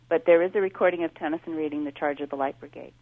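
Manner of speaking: ordinary talk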